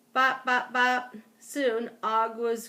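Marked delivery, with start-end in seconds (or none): 0.1-1.2 high, punchy bops; short, loud thumping sound words; bopping noise. A narrator's reading. Frog hopping toward the trapped hamster, bopping. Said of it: "Then I heard a new sound".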